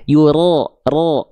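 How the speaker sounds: "Yuro, ro" dengan ro tebal seperti vokal o. Shown as a demonstration. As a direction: pangkal lidah naik pada "ro". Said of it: "ro terdengar seperti vokal o". ("ro" dibaca tebal mendekati o)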